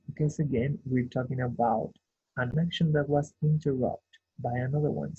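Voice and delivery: ordinary talk, nothing marked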